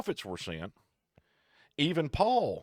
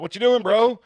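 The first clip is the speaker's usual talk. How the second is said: high, loud sassy donkey voice; a donkey talking back to Balaam, cheeky; mock donkey asking what's up; 12 semitones higher, 13 dB louder than usual.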